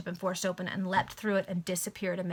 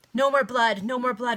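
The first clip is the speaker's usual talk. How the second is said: louder pleading cry of horror; horrified plea; 3 semitones higher, 7 dB louder than usual. Acted. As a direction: beg them, appalled. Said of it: "with an accent of horror".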